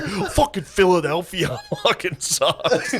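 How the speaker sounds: high, slow laughing complaint; mock angry rant. Comics on the ride home, a slow laughing gripe. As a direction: gripe while cracking up. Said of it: "them being like".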